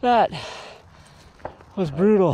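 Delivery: out of breath after a hard sprint; winded, lungs burning; after a workout. Real, not acted. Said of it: "Lungs are burning".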